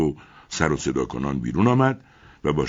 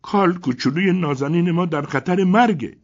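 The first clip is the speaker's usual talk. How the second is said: high lament, a touch louder; wailing; 13 semitones higher, 7 dB louder than usual. A narrator's reading. Owner's wife wailing about her crocodile, high-pitched. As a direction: wail over the dying pet. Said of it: "زنش شیون کنان گفت".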